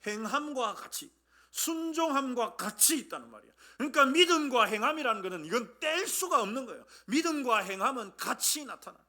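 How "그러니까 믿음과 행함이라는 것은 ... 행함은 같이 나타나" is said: loud, raised, insistent preaching; emphatic, ringing pulpit delivery; preaching at full force. Real, not acted. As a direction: hammer the point home to the congregation.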